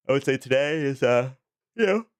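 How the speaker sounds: talking through a yawn; words stretched by a yawn. Real, not acted. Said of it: "I don't know why I'm yawning now".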